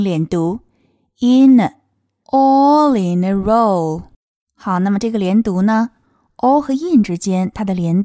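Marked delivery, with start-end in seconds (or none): 1.2-4.0 "all in a row" linked, very slow and louder; linked demo. Shown as a demonstration. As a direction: glide "all" into "in" and "in" into "a", slowly. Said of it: "可以进行连读". (慢速连读示范)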